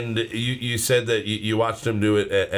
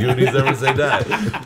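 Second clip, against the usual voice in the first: laughing; 4 semitones higher, 4 dB louder than usual. Retold or imitated. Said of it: "Justin just goes".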